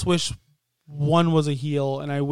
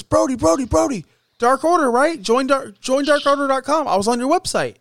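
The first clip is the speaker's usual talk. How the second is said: higher, louder, eager fanboy gush; 9 semitones higher, 3 dB louder than usual. Retold or imitated. Adam Page chasing down Brody, eager higher louder gush. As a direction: rush up gushing like a starstruck fan. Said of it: "Adam Page runs up there and goes".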